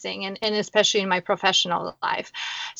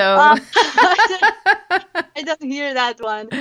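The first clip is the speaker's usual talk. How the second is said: high, slow laughing start, then settling; 7 semitones higher, slower than usual. Real, not acted. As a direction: laugh through the words in amusement. Laughing at host's Wisconsin accent.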